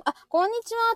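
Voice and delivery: usual speaking voice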